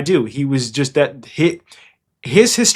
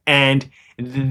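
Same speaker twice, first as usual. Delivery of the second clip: usual speaking voice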